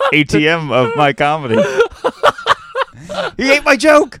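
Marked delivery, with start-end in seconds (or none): 1.6-4.2 high, giggly punchline; laughing. Real, not acted. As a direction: laugh the line out. Laughing at his own line.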